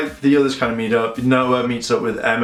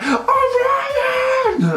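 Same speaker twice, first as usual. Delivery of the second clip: very high, drawn-out mock squeal; imitated overjoyed cry. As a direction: shriek the name in hammed-up delight. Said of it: "Emma sounds really happy".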